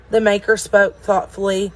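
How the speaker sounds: ordinary delivery, nothing marked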